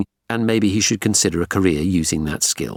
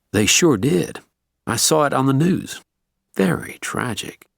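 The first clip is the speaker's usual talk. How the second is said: put-on Southern US accent; 7 semitones higher than usual. A narrator's reading. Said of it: "polite Southern U S accent".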